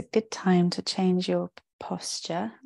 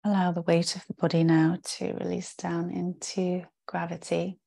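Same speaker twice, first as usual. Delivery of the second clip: guided meditation. Real, not acted. Yoga teacher settling the class into rest, calm guided-meditation voice. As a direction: guide them into rest, with spacious pauses between phrases. Leads a yoga nidra practice.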